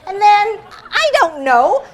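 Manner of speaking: made-up answer. Retold or imitated. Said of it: "And I'm like".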